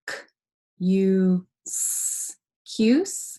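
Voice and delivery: k, u, s, cuse segmented slowly; sounded out with S. Shown as a demonstration. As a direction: break excuse into sounds, S hissed. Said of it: "like excuse".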